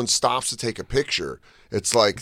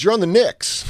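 high, eager exclamation; confident fan; 10 semitones higher than usual. Retold or imitated. Lady answering Che who he is, excited. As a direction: blurt the answer with mistaken certainty.

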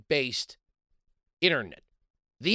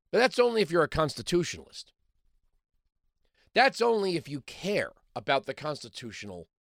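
ordinary delivery

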